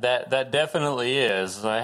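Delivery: usual speaking voice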